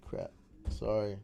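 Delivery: ordinary talk, nothing marked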